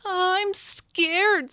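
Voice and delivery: high, small frightened voice; a timid child afraid to try; scared little ladybug. Acted. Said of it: "said Lottie".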